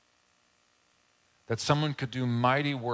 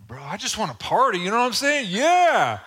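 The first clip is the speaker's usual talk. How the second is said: high, loud whooping party-bro voice; mock a hyped-up bro, building to a yelled "yeah"; rowdy young party bro; 10 semitones higher, 9 dB louder than usual. Young champion hollering to a newscaster on national TV, rowdy.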